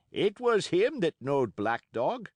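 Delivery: put-on old seaman accusing. A narrator's reading. Morgan accusing the boy.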